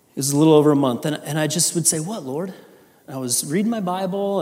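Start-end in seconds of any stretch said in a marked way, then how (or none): 2.0-2.5 soft, hushed asking of God; quiet plea. Retold or imitated. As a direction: whisper the question to the Lord. Pleading prayer retold.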